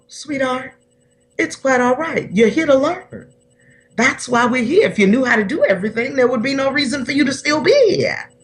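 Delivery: daddy's gentle voice. Retold or imitated. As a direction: gently comfort your daughter, a hint of Dad. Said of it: "He'd go".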